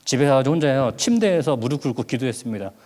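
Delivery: usual speaking voice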